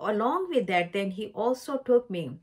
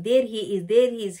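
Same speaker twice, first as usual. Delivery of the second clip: voicing the Lord's words, louder and pressed; emphatic repeat; 6 dB louder than usual. Preacher quoting the Lord announcing the seed, emphatic. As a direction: repeat it twice with weight. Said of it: "so he is laying emphasis".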